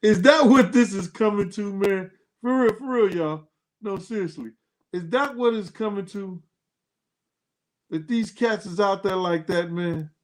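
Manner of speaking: hammed-up high squeak of disbelief; mock disbelief. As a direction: play it up like you can't believe it.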